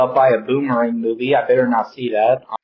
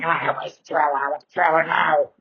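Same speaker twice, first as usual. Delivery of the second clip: put-on higher, whining like a bratty kid; whiny little brat; 4 semitones higher than usual. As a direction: whine it like a spoiled child. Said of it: "I may sound like a whiny little brat going".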